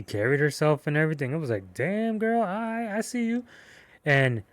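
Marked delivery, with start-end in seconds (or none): 1.7-3.4 high-pitched, put-on appreciative reaction; mock flirty compliment in a high voice; playful admiration. Acting out his admiring reaction.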